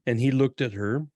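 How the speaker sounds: ordinary talk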